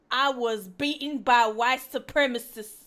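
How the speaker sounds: loud, put-on gossipy Yenta reading; mock Yenta voice. Streamer reading a donor's post in a loud Yenta voice. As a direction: read the post as a nagging Yenta. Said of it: "I'll say it in a Yenta voice".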